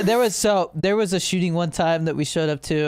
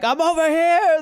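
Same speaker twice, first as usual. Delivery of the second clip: calling out; 12 semitones higher, 4 dB louder, slower than usual. Retold or imitated. Hidden body calling paramedics.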